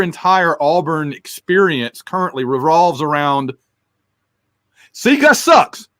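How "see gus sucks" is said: mocking bitter fan. Mocking fans who hate coach.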